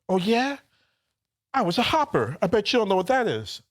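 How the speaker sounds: lightly voiced know-it-all drunk; drunk regular's voice. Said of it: "his voice gets all high".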